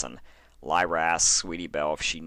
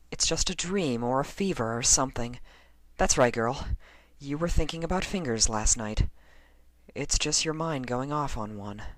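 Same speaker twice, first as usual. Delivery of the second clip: put-on higher, lighter young mare voice; Lyra's voice; 8 semitones higher than usual. A narrator's reading. Lyra reassuring herself, higher voice.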